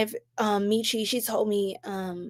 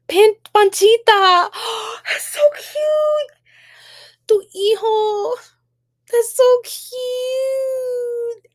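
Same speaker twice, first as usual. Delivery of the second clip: high, slow squeals of delight; 12 semitones higher, 6 dB louder, much slower than usual. Real, not acted. Streamer squealing at a viewer's baby photo, high delighted coo.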